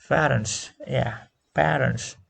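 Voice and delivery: "parents" with an open "a" like in cat; teacher's model word. Shown as a demonstration. Teacher drills "parents" for the class, open vowel. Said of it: "is pronounced as fa, a, a".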